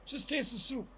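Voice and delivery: high mock shouting voice, pushing someone to taste; Coming to America impression. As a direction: bark it like the pushy soup scene. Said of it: "have you seen Coming to America?".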